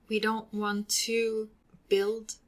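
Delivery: ordinary talk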